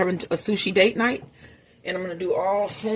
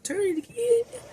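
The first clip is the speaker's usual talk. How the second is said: imitating her own voice cracking high on "Turn it again."; a mock cracked high squeak on "Turn it again.". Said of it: "My voice just cracked".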